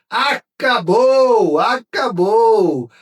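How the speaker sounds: high, loud, stretched-out cry; commentator's victory shout. Retold or imitated. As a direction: shout it out long, celebrating that it's over. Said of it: "comecei este vídeo dizendo".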